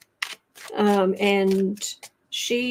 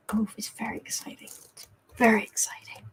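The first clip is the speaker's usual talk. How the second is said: whisper; 7 dB quieter, faster than usual. Real, not acted. Excited aside while reading cards.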